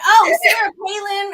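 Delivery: mock Palin voice, slowed and lifted; Sarah Palin impression. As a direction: toss off a quick, half-hearted politician imitation. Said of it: "I'd talk like her".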